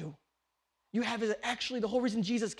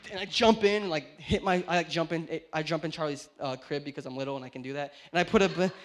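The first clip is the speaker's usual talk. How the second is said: ordinary talk, nothing marked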